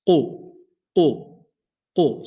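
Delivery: qu with the back of the tongue on the soft palate; heavy qaf. Shown as a demonstration. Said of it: "it touches the soft palate".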